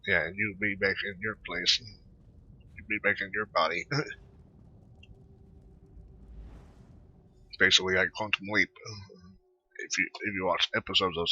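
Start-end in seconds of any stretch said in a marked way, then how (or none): 3.9-7.5 long airy laugh. Real, not acted. Gamer laughing at the body-swap twist, airy laugh. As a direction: burst out laughing at the game.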